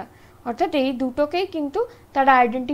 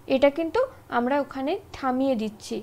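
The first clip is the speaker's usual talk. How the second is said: ordinary talk, nothing marked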